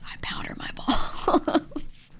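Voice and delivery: slow, whispered secret breaking into laughter; confiding. Retold or imitated. Imitating friend leaning in with his secret, slow confiding whisper. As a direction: whisper it slowly, as if leaning in close, then crack up. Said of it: "lean into our friend and be like".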